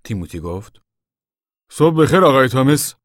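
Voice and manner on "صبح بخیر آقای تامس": high, loud, ringing good morning; raised, high-pitched hail; loud greeting. A narrator's reading. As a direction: call out a greeting to the boss. Timothy greets farmer aloud.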